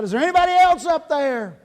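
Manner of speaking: high, loud holler upward; yelling out. Acted. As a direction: shout for anyone else who might help. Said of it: "he finally yells out".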